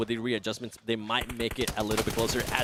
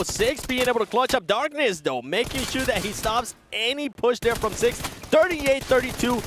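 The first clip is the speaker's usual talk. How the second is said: excited play-by-play; 11 semitones higher, 4 dB louder, slower than usual. Real, not acted.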